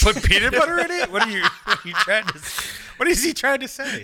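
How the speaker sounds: very high, laughing through the words; cracking up mid-question. Real, not acted. Friend laughs at skippy, asking what he means. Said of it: "laughing bafflement".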